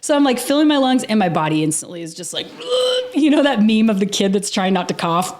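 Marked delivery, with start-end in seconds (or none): 2.5-3.1 high giggle. Retold or imitated. Coughing urge, laughing it off.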